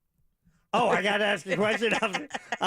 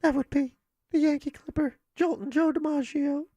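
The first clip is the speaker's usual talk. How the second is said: breathy high starlet voice, much higher; high, breathy and wounded; Marilyn Monroe; 8 semitones higher than usual. Pretend. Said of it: "Miss Marilyn Monroe".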